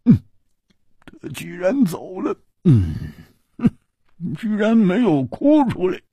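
high, rough-edged swordsman's voice, half to himself; Hu Tiehua mumbling to himself, high and gruff; murmuring. Acted. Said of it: "喃喃地说".

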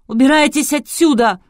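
high, loud, spiteful yell; vicious shriek. A narrator's reading. Vasilisa orders them out, shrieking spitefully. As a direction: shriek it angrily. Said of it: "злобно взвизгнула Василиса".

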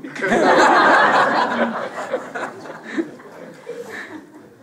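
high, loud, breathless burst of laughter; hearty long laugh. Real, not acted. Long laugh after the joke.

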